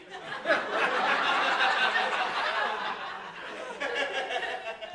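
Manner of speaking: high unvoiced laugh that fades out; long breathy laugh. Real, not acted. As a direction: laugh helplessly at the memory. Laughing at his own story.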